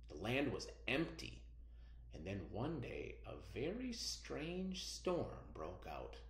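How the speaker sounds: slow, hushed folk tale narration with bright peaks; storytelling read-aloud. Acted. Teacher reading a folk tale to her students, slow and hushed.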